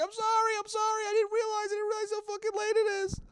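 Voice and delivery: put-on scared kid. Imitating his younger self pleading with his dad. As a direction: squeak it out like a terrified kid. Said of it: "I'm like".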